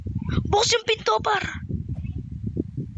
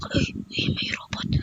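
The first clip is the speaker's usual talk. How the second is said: whisper; 5 dB quieter than usual. Acted. Hiding from robot while sneaking.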